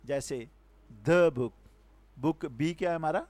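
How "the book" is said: "the" with a short schwa before book; "the" as "duh". Shown as a demonstration. Teacher giving a consonant-sound example, "the" said "duh". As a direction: punch "the" as a loud, brief "duh". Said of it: "یہ کانسوننٹ sound ہے".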